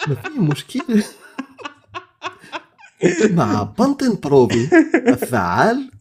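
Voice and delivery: high, fast, sing-song advert voice; shampoo ad voice. Pretend. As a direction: rattle it off like a TV ad. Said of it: "la voix dans toutes les pubs".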